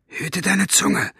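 high, slow menacing hiss; hissed threat. A narrator's reading. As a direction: spit the warning out slowly. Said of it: "zischte Buster ihn an".

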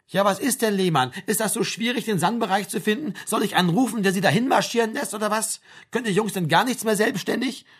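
high-pitched, impatient rant of questions; exasperated army sergeant. Acted. Sergeant asks if finding sickbay is hard, exasperated. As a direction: despair at a helpless conscript.

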